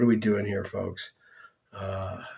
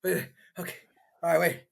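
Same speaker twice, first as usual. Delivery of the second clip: high-pitched jittery guy voice, much higher throughout; comic character voice; 13 semitones higher than usual. Acted. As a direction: play a nervous, excitable man rushing in. [name] arrives in character voice.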